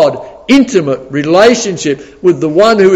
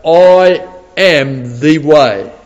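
slow solemn quote; much slower than usual. Acted. Quoting Jesus' absolute statement.

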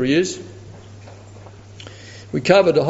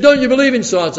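incredulous challenge; 8 semitones higher, 5 dB louder than usual. Retold or imitated. Atheist incredulous.

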